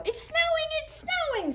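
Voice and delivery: high, squeaky frog delight; excited. Acted. Froggy seeing snow from the window, high excited squeal. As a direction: bounce with joy at the window.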